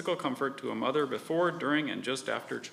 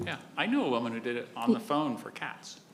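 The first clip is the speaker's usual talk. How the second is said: ordinary delivery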